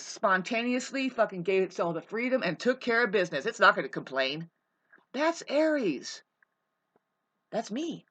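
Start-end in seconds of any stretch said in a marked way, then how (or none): none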